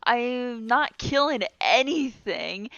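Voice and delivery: ordinary talk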